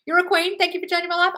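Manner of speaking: high, loud, gushing thanks; gushing fan. Acted. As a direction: read it like a thrilled fan. Reading a grateful comment aloud.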